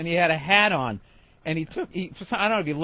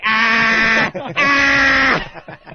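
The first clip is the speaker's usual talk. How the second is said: loud high cackling scream; imitated clown scream; 3 semitones higher, 7 dB louder than usual. Copying the clown's taunting shriek, loud and high. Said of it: "he's like".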